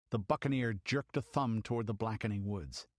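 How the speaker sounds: ordinary delivery, nothing marked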